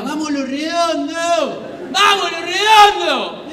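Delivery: high, drawn-out mock fan chant; hammed-up, loud rallying shout; fans yelling in your face. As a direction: bellow it slowly like rowdy fans. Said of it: "a gritar en la cara".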